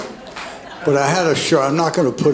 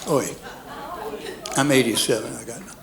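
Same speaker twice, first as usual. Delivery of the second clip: Georgia football player; 4 semitones higher than usual. Retold or imitated. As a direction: hype yourself up like a swaggering linebacker. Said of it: "My imitation of a Georgia football player".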